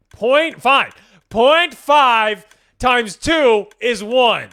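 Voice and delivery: exasperated yelling explanation; frustrated shout. Real, not acted. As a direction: belt it out word by word in frustration. Frustrated math, shouting slowly.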